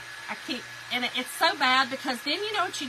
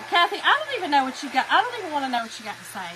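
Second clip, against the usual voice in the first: playful brush-off; 4 semitones higher, 6 dB louder, faster than usual. Real, not acted. Teasing a chat commenter.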